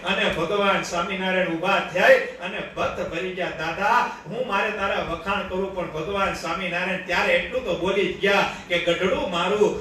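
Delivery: usual speaking voice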